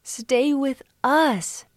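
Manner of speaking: high, drawn-out plea; begging. A narrator's reading. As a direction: beg slowly in a high voice. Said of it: "they begged".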